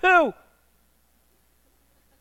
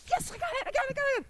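much higher, hushed squeal of joy; giddy kid; 11 dB quieter, faster than usual. Retold or imitated. Kid finding hidden present.

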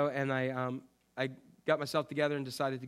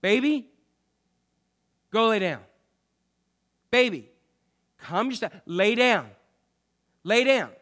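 raised, firm calls to the dog, higher and louder; commanding the dog; 5 semitones higher, 7 dB louder than usual. Retold or imitated. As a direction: tell an excited dog to settle down, firmly. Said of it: "what I tell her".

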